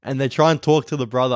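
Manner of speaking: ordinary delivery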